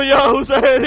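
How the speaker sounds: a loud impassioned call of Ya Hussain. Acted. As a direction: call یا حسین out loud to the crowd. Woman calls out before Zainab. (یا حسین کو بلند آواز سے پکارنا)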